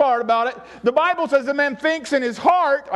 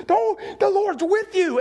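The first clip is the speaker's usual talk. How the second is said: much higher, bright proclamation; excited angel; 9 semitones higher, slower than usual. Acted. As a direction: announce it with wonder. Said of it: "He says".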